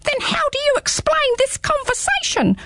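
squeaky small creature, teasing; little hedgehog voice. Acted. Hedgehog speaks in story.

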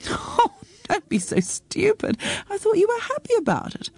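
high, acted exasperation; exasperated daughter. Daughter scolds her upset mother.

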